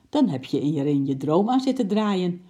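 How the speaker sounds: plain reading voice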